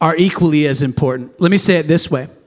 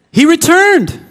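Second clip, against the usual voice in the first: proclaiming; 10 semitones higher, 4 dB louder than usual. Real, not acted. Proclaiming return.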